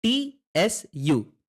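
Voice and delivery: टी एस यू spelled slowly, letter by letter; slow letter-by-letter spelling of चू. Shown as a demonstration. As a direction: spell out टी एस यू for the learner. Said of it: "ये है".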